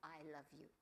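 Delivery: soft, tender I love you. Shown as a demonstration. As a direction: say it softly and sincerely. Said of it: "Three magic words for women".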